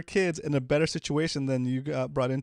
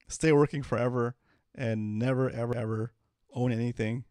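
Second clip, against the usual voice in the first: usual speaking voice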